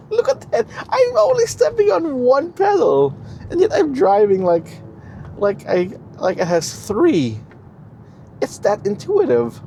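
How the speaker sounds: gushing with delight behind the wheel; thrilled. Real, not acted. Thrilled driving a new EV.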